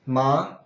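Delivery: MA rising like a question; second tone. Shown as a demonstration. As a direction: lift the pitch through MA.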